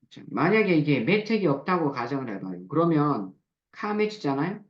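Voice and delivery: ordinary talk, nothing marked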